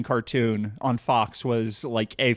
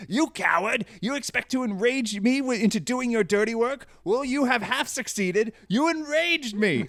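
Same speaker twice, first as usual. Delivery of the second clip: higher, held-back mock yelling, a hint of rage; toned-down Cravex rant; 7 semitones higher than usual. Retelling Cravex's outburst to the cohost, a muted mock rage. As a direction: play the furious villain without actually shouting. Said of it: "I'm not gonna do the voice because I have neighbors".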